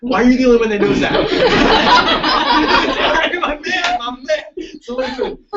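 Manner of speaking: high, fast laughing. Real, not acted. Speaker laughs at the one student who knew, laughing.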